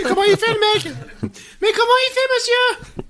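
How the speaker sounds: very high squeaky voice. Pretend. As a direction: squeak it out in a tiny voice. Silly high-pitched voice. (petite voix aiguë)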